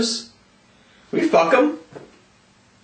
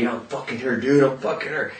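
confiding; 5 semitones lower, 6 dB quieter than usual. Retold or imitated. Retelling what people confide to him.

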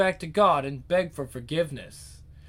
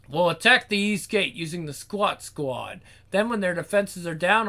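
ordinary delivery

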